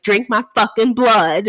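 loud mock-furious order; pissed off. Angry heroine ordering her wounded mate, acted fury. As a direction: punch it out as an exasperated command. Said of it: "She's pissed".

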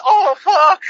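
high, loud yelp of pain in mock; mock officer yelp. Telling a mate how the officer cried out, a mock high yelp. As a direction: squeal it like a hurt cop, hammed up. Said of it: "And he went".

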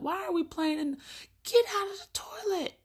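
high, sudden scold; startled scolding. Retold or imitated. Mom catching daughter playing in the toilet, high startled scold. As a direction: yank her out of the toilet with the voice.

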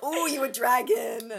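loud, slow teasing ooh; playful exclaim. Real, not acted. Friend teasing about fire breath, loud playful. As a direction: tease her like she's a dragon.

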